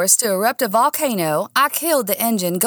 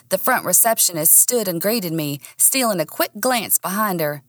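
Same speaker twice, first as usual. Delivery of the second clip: ordinary delivery, nothing marked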